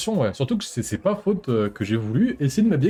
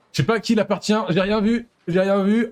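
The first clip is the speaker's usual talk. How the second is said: mock innocent; 7 semitones higher than usual. Pretending innocence stealing clip.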